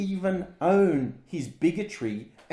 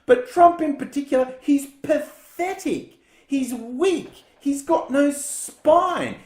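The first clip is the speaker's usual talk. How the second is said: rising rant; 7 semitones higher, 4 dB louder, slower than usual. Real, not acted. Escalating rant against Trump.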